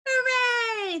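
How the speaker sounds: high-pitched, drawn-out hooray; triumphant cheer. A narrator's reading. Henry finding the tooth gone from his pillow, high gleeful cheer. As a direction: cheer with childish glee. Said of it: "thought Henry".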